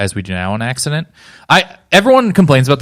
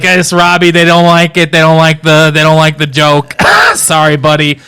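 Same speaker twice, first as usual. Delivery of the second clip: loud, raised mock announcement; Adam Sandler; 4 semitones higher, 6 dB louder than usual. Headliner telling his friend the joke is bombing, loud impression. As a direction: call it out high and loud, buddy to buddy. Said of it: "Adam Sandler got on the God mic".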